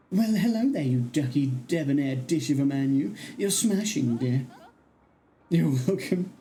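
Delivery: theatrical, swooping character voice; flirtatious enchantress. Acted. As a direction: gush like a flattered, self-admiring charmer. Voices a flattering game character.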